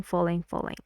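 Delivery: normal talking voice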